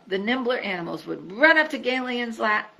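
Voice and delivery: ordinary delivery, nothing marked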